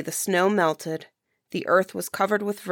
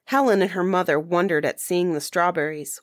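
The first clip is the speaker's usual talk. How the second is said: usual speaking voice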